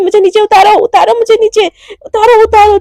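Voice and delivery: high, loud squeals to be put down; yelling put me down; protesting yell. Acted. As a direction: kick and shout to be let go. Mishka carried off, protesting.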